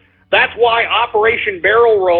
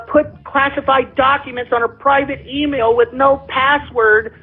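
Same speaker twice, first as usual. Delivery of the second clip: lightly higher, sarcastic gushing mimicry of pundits; mock the fawning press, a little raised; mocking media gush; 4 semitones higher than usual. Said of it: "look at all the propaganda".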